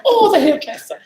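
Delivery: very high, loud mock gush; gushing realization. Replaying her reply to the visitors, high laughing gush. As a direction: replay it as a delighted squeal, half laughing.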